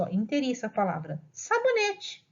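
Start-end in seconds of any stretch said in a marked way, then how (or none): 1.3-2.3 put-on "sabonete" said all at once in a much higher child voice; child reading whole word. Teacher imitating a child reading her word, high and all at once. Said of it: "ela vai falar de inteiriço".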